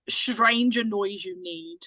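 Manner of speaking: normal talking voice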